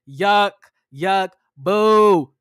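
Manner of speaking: loud mock boos at a reality villain; hammed-up heckling; playful booing. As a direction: boo him off like a heckler. Booing Jamie.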